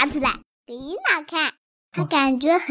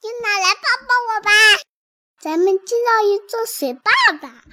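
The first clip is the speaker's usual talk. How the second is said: higher, quick, excited call; excited shout; 5 semitones higher, 6 dB louder than usual. Acted. Child calling Yina over to play in the stream, excited shout. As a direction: call out eagerly to a playmate. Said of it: "我兴奋地喊起来".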